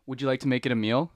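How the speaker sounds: loud, rising sales offer; upselling cashier. Pretend. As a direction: push the meal deal brightly. Roleplay drive-through cashier.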